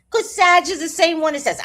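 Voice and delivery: ordinary delivery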